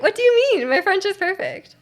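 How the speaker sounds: high-pitched playful protest; mock indignation. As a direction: feign offence, pitch jumping up. Mock protest at viewer's jab.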